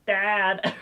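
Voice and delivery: high, loud mock whine; Hiccup whining. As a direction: imitate a whiny son calling for his dad. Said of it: "He's really whiny".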